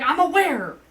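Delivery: loud, high-pitched mock yell; retold irritated outburst; exasperated retort. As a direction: throw it back loudly, fed up. Retelling exasperated reply to drunk.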